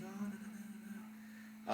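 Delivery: high, soft rapid-fire mock denial; light high-pitched string of no's; imitating the date. Voicing the date's denial.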